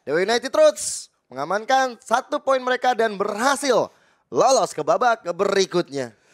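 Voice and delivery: loud, slow winning call; victory announcement. Real, not acted. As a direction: announce the winner proudly.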